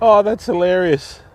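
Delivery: usual speaking voice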